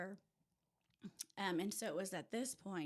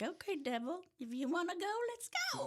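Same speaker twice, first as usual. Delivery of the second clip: high, feisty dare building in pitch; mock-fierce, climbing higher to the end; defiant challenge; 10 semitones higher, 4 dB louder, faster than usual. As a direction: square up for a fight, playfully. Acts out challenging the devil.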